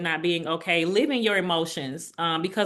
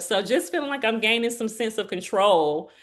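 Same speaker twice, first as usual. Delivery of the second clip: normal talking voice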